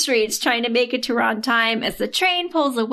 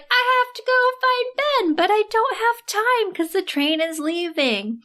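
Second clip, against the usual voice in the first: much higher, urgent and breathless; frantic heroine; 10 semitones higher than usual. Retold or imitated. Heroine torn between train and Ben, high panic. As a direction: squeak it out in a panic. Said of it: "And she's like".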